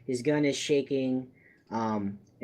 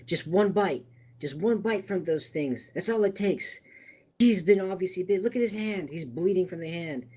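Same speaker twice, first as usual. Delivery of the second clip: higher, urgent gunman warning the others; lightly voiced tense man with a gun; panicky gunman; 6 semitones higher than usual. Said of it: "turns to you and says".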